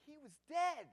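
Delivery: loud high grief-stricken cry; anguished cry. Acted. First take, anguished outburst.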